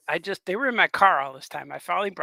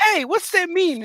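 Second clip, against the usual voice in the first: much higher, slow, mock-offended; mock indignant question; 14 semitones higher, 6 dB louder, slower than usual. Husband voicing himself questioning his wife, mock offended. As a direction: put on a high, wounded voice.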